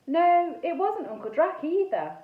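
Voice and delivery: plain reading voice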